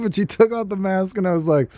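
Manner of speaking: ordinary talk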